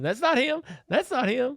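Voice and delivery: put-on high, loud girls' voice, repeated. Dad voicing the girls rejecting each passing car, high and loud. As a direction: call it out like bored kids at a window.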